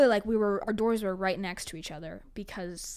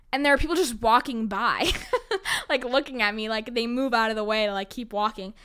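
ordinary delivery

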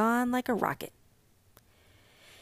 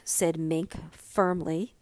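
usual speaking voice

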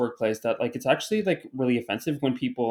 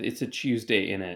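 quieter put-on British accent; mock British accent; 4 semitones lower, 6 dB quieter than usual. Host admitting how they opened the show, mock British accent. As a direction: requote it in jokey British. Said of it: "I did open this catch up with".

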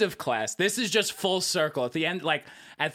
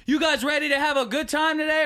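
high, rousing class-leader call; hyped spin instructor; 8 semitones higher, 3 dB louder than usual. Retold or imitated. Spin instructor asking riders if they're ready, rousing call. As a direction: pump up the room like an instructor.

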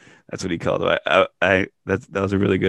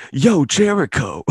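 drawn-out, higher, louder "Yo, Jericho"; a drawled mock callout; hammed-up Matt Jackson call; 7 semitones higher, 5 dB louder, slower than usual. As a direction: call him out slowly with swagger. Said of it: "Matt Jackson goes".

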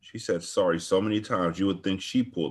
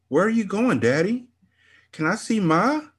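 much higher, pleading calls; desperate plea; 12 semitones higher than usual. Acted. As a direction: call after him as he leaves. Daughter calls after leaving father.

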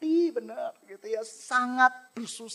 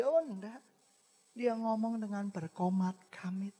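ordinary delivery

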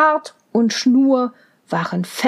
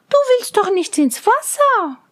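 Mädchenstimme; 8 semitones higher than usual. A narrator's reading. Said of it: "sagte Rosenrot".

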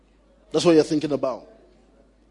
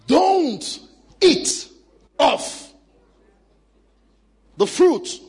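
voice of God; 7 semitones higher, much slower than usual. Acted. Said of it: "That is of the tree".

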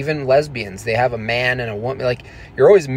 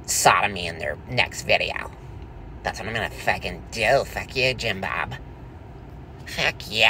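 tag; 3 semitones lower, 6 dB quieter than usual. Acted. Plays the threatening boyfriend ranting.

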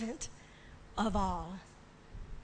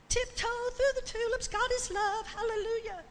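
mock light piety; 10 semitones higher than usual.

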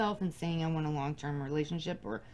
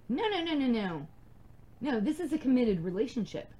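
quick high run of no's, louder, cutting him off; insistent refusal; 6 semitones higher, 3 dB louder than usual. Retold or imitated. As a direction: refuse his idea outright, in mock protest. Acted argument with boyfriend.

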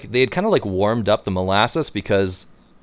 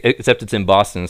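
usual speaking voice

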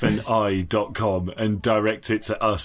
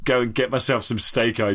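ordinary talk

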